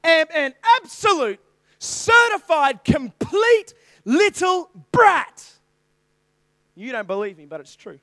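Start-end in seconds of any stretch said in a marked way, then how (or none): 0.0-5.5 slow, loud, higher declaration; loud, slow self-accusation; emphatic confession. Real, not acted. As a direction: slowly hammer out each word of it. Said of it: "the truth dawned on me".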